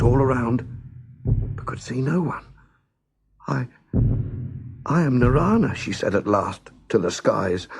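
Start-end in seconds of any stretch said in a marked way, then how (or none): 3.5-5.7 timid, hesitant answer; trembling with fear. Acted. Lost girl answers unseen giant, trembling. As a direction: stammer it out to the sky. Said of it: "her voice trembling with fear".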